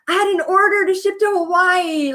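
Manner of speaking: high, thrilled retelling of dad; happy dad. Retold or imitated. Dad bragging about a Hawaii order, high and happy. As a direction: sound delighted like her proud dad.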